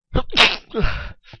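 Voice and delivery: sudden loud sneeze; a sharp unvoiced sneeze; sneezing. Real, not acted. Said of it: "je vais éternuer". (éternuement brusque et fort)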